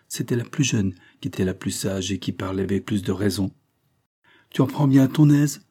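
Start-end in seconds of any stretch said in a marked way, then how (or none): 4.5-5.6 higher, louder indignant outburst; sudden exclamation. A narrator's reading. Sidonie rounds on her friend, exclaiming. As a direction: cry out in sudden protest. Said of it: "s'écria tout à coup Sidonie".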